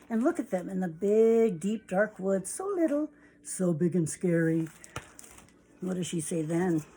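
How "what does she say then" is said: plain reading voice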